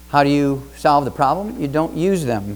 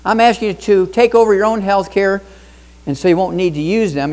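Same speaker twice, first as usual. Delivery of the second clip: ordinary delivery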